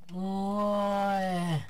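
long loud drawn-out yeah; excited yeah. Real, not acted. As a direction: stretch it out, thrilled. Streamer's loud drawn-out reaction.

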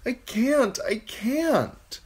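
put-on high, pleading boy voice; frightened boy. A narrator's reading. Bran protesting that he cannot fly, high and pleading. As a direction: wail it helplessly.

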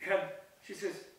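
ordinary delivery

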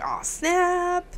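high, soft awed reaction; mock amazement. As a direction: act impressed in a put-on high voice. Acts promoted men's reaction.

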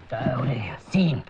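slow, whispered threat; menacing whisper. Acted. Interrogator calling the suspect over, slow menacing whisper.

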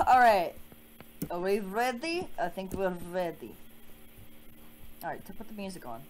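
ordinary delivery

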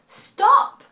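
squeaky high yelp of a cat; shrill stop as the cat; high and loud cat voice; high cat cry. A narrator's reading. Cat voiced in read-aloud story.

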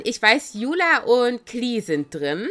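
ordinary talk

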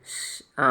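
soft unvoiced shush to a crying child; a quiet shh to calm a sobbing girl. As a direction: hush her gently, barely breathed.

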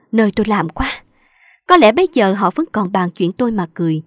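ordinary delivery

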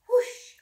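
high, loud rushing whoosh. A narrator's reading. The scary thing fleeing, loud high whoosh. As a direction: blast it out like wind. The monster flees.

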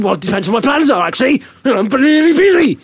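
hammed-up brush-off climbing in pitch; mocking retold excuse. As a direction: imitate a busy man's excuse, exaggerated. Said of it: "I didn't say like that at all".

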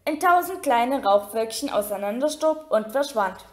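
ordinary delivery

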